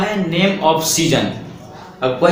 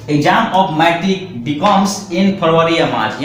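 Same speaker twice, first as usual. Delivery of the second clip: slow dictation; much slower than usual. Shown as a demonstration. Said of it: "teacher dictates example sentence".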